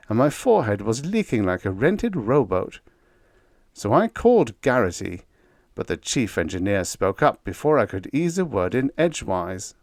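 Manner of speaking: plain reading voice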